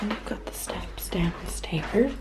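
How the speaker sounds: whispering. Real, not acted. Whispered night house tour.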